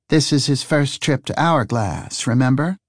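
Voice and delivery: lightly voiced woman detective. Detective explains to lumberjack.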